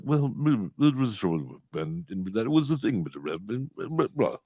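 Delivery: mumbled mock British gibberish; unintelligible British accent. Baffled podcaster imitating a visitor's accent, unintelligible British mumble. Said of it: "I couldn't understand a word he said".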